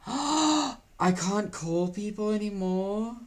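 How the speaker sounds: dramatic shocked gasp and slow question; shocked disbelief. Real, not acted. App feature removed.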